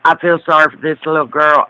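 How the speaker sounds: ordinary talk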